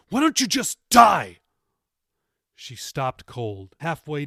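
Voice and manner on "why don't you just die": very loud, high hateful yell; angry grandson yelling a death wish; cruel shout. A narrator's reading. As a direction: hurl it at her.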